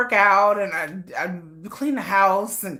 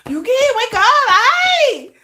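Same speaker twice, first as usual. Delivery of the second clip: loud high wake-up call; 8 dB louder, much slower than usual. Retold or imitated. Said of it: "and be like".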